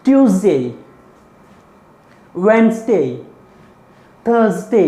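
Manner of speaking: ट्यूसडे वेडनेसडे थर्सडे each with a z; teacher drilling the z days; z-sound drill. Shown as a demonstration. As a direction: list them with a clear z each. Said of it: "ज है, ये याद रखें".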